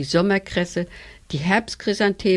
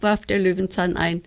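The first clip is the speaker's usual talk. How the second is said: usual speaking voice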